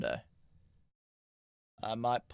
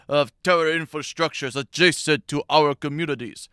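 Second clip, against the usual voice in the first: Netanyahu accent impression; 3 semitones higher, 8 dB louder than usual. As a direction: do Netanyahu's Israeli accent as a loud, formal announcement. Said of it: "imitation of his accent".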